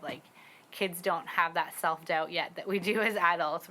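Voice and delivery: usual speaking voice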